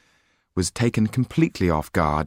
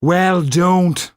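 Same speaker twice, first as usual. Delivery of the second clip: sharp, higher and louder dismissal; curt retort; 11 semitones higher, 7 dB louder than usual. Acted. Chef snapping back at the gloomy coachman, curt and loud. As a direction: shut him down fast.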